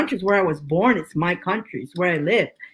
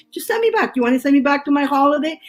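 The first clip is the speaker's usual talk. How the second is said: mock pleading; 6 semitones higher, faster than usual. Retold plea to officer.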